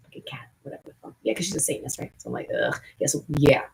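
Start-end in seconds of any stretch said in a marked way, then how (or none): 0.1-1.1 fast, dismissive whisper; rushed aside, barely voiced. Real, not acted. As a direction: mutter it under your breath quickly.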